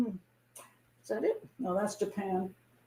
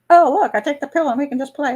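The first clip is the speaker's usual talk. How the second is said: carefree mock voice; 5 semitones higher, 5 dB louder than usual. Imitating free-love era voice.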